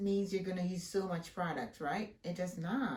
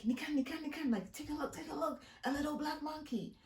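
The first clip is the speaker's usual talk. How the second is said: high, mocking grandma voice, pointing out; high sneering old woman; 8 semitones higher, 4 dB quieter, faster than usual. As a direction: imitate a scornful Chinese grandma, pitched up. Grandma mocks girl to grandson.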